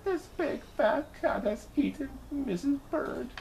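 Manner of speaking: high, thin, wailing bird voice; grieving cry. A narrator's reading. Mister Bird sees the cat and wails, high and grieving. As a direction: wail it out in despair. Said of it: "he cried".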